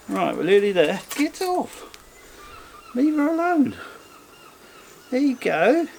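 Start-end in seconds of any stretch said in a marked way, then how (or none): 1.1-5.9 much higher, sing-song shooing; high mum voice. Pretend. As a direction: shoo the cat off in a high coo. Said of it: "I should be talking really high pitched".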